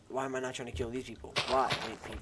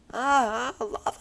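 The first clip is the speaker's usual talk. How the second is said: much higher, loud yelp of alarm; 12 dB louder, much slower than usual. Real, not acted. Spotting lava while digging, squealing high. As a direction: squeal at the sudden danger.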